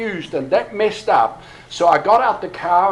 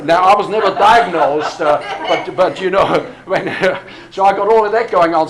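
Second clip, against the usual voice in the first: chuckling through the words, a bit louder; laughing while talking; 2 semitones higher, 7 dB louder than usual. Real, not acted. Laughing while admitting undiagnosed ADHD.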